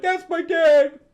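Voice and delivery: put-on high, loud boasting kid voice; proud kid Robin. Robin bragging about Batman to the team, high and proud. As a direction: crow it like a proud little son.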